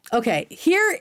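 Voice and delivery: ordinary talk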